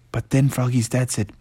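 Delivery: plain reading voice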